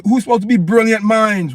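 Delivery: normal talking voice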